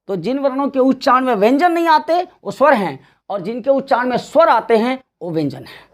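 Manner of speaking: normal talking voice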